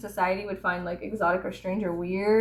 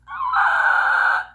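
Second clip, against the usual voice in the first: high breathy shriek of embarrassment; mock scream; 7 dB louder than usual. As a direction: let out a strangled shriek. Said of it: "I would just be like".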